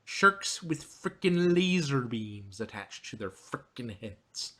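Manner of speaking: slow, deadpan evil-mastermind voice, dropping away at the end; hammed-up Dr. Evil delivering his famous line; Doctor Evil impression. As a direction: savor each word like a smug supervillain. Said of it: "that impression".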